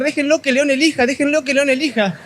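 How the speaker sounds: high, pleading punchline yell; mock desperate shout. Lame man pleads with the crowd, high mock yell. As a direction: beg them like a man fleeing a lion. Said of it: "Y el rengo empieza a gritar".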